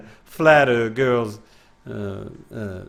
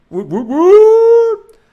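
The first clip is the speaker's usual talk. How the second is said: cheering; 15 dB louder, much slower than usual. Pretend. Cheering in chat.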